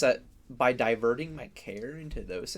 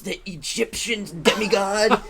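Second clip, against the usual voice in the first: louder, higher, drawn-out mock narration; mock movie-trailer narrator; 8 semitones higher, 9 dB louder, slower than usual. Friends parody an eighties coming of age movie, hammed-up narration. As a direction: play a hammed-up trailer voice-over.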